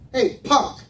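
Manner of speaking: loud, high threatening yell; acting out an outraged shout; Kanalu's angry yell. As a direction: holler a threat after someone. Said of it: "Kanalu yells out".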